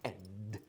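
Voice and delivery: "ed" said soft, short schwa plus d; a quiet, clipped "ed"; ed demo. Shown as a demonstration. Teacher showing the schwa plus D sound. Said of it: "it's gonna be like ed".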